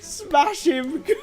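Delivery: high, slow, laughing shouted cheer; hammed-up bloodthirsty cheering; mock battle cheer. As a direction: bellow it like a giddy cheerleader. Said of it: "She's like".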